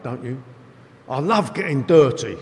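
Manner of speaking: ordinary talk, nothing marked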